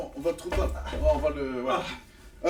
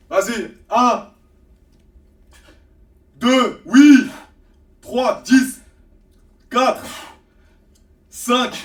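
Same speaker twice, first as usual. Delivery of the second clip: loud coach count, rising pitch; counting reps loudly; 5 semitones higher, 9 dB louder, much slower than usual. Real, not acted. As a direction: shout out each rep like a trainer.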